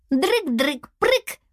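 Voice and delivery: high, choppy nonsense syllables; babbling little creature. A narrator's reading. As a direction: chirp it in a tiny voice. Small creature Aš's babble. (тоненьким голоском, по слогам)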